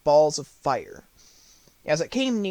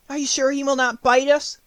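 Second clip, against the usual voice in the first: timid voice; 9 semitones higher, 3 dB louder than usual. Acted. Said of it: "in a timid voice".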